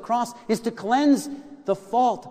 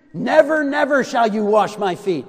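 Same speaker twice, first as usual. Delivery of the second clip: loud, high-pitched protest as Peter; emphatic refusal; 4 semitones higher, 9 dB louder than usual. Acted. Peter refusing to let his Lord wash his feet, emphatic. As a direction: refuse forcefully, voice raised on every word. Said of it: "Emphatic, in, in, in the original language".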